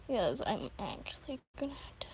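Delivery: fast breathy whisper. Real, not acted. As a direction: whisper it quickly aside. Whispered aside while streaming.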